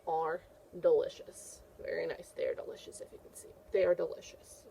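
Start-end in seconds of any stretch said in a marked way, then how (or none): 2.4-3.5 hushed conspiratorial whisper; soft storytime whisper. Acted. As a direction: whisper it like a funny secret. Teacher repeats child's answer.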